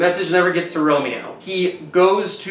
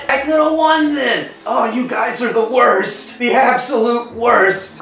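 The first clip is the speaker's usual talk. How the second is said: dying Mercutio; 6 semitones higher, 3 dB louder than usual. Retold or imitated. Stabbed Mercutio cursing both families, strained and higher. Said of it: "Mercutio is like".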